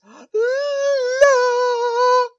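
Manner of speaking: loud high shriek. Pretend. Vocal warm-up shriek.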